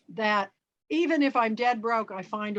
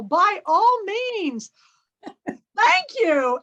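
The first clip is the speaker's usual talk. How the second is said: delighted laughing thanks; 7 semitones higher, 6 dB louder, much slower than usual. Retold or imitated. Delighted thanks to gas attendant.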